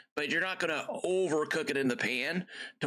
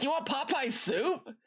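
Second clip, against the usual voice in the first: much higher, slow puzzled question; confused dad; 12 semitones higher, slower than usual. Retold or imitated. Dad checking his daughter's dinner order, mock confused. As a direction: rise up, baffled, slowly.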